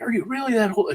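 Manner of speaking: high-pitched imitation of an amazed question; retold disbelief. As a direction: act out someone falling for it.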